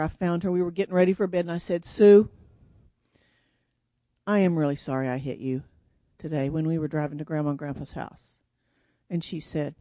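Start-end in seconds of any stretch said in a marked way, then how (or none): 1.9-8.4 low, subdued retold apology; sincere apology. Girl to her sister at bedtime, sincerely sorry. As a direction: lower the voice and apologize earnestly.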